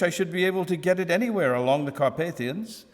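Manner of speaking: plain reading voice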